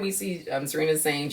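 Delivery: ordinary talk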